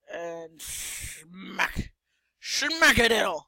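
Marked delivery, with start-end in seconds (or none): none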